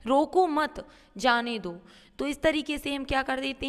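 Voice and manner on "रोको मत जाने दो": रोको मत as one group, then जाने दो; pause after मत. Shown as a demonstration. Teacher reading what the comma says, break after मत. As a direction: keep मत with रोको, let जाने दो fall after it. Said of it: "अल्प विराम क्या कह रहा है".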